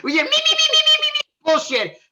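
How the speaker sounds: very high, loud squealing mimicry; shouted mockery. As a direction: screech the repeated “me” to mock him. Mocks co-host's repeated me.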